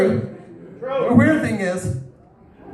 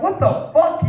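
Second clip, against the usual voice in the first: shocked; 9 semitones higher than usual. Retold or imitated. Pit bull with rabbit.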